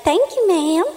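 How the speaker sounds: high, loud, self-satisfied thanks; mock a friend lapping up the praise; cocky. Friend answering the old lady's compliment, imitated cocky and high. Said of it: "Pam got all cocky and everything".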